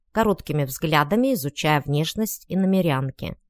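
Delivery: plain reading voice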